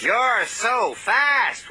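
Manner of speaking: admiring. A narrator's reading. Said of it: "wished Rheneas".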